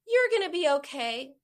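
dismissive reassurance. Retold or imitated. Mom to her crying daughter, dismissive reassurance. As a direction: wave the pain away brightly. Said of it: "I dismissed it".

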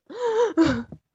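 high, drawn-out gasping breath; mimicked post-tantrum gasp; imitated child's gasp. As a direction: gasp like a kid coming out of a tantrum. Imitates kid ending a tantrum.

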